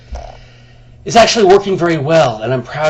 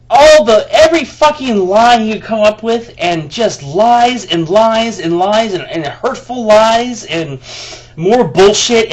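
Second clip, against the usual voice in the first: loud, high, furious ranting; real anger building into a high, loud rant; angry rant; 7 semitones higher, 6 dB louder, slower than usual. Real, not acted. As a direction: vent the outrage at the lies. Ranting about the hurtful email.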